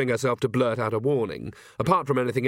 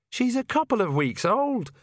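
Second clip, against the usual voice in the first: high-pitched exasperated protest; disbelieving young witch; incredulous; 12 semitones higher than usual. Acted. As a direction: point out the absurdity, voice raised in pitch. Disbelief about baby's early development.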